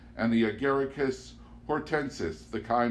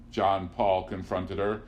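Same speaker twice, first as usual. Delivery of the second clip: ordinary delivery, nothing marked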